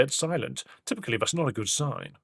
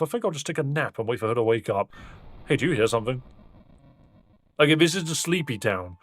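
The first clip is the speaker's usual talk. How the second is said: ordinary talk, nothing marked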